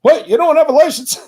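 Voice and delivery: very high, loud, outraged disbelief; mock shocked cop. Cop hearing he has no license, hammed-up shock. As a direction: squawk it like a startled cop. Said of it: "And they".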